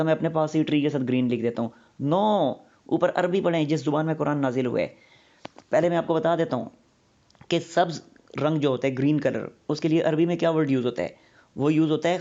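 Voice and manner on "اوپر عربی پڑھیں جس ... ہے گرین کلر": usual speaking voice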